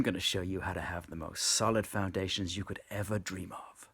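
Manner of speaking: breathy guru whisper. Acted. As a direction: whisper it like a secret promise. Guru hush.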